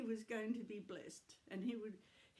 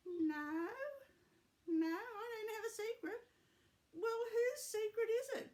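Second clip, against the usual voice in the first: grandma voice; 11 semitones higher, 3 dB louder than usual. Acted. Grandma puppet voice.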